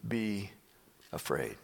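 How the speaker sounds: low, hushed reassurance with long pauses; calm. Acted. Prophet reassures his terrified servant, calm. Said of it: "he smiles and calmly says".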